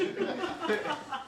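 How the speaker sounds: a short burst of laughter; real laugh. Real, not acted. Congregation hears him laugh at his own story, a real laugh. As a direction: laugh at yourself.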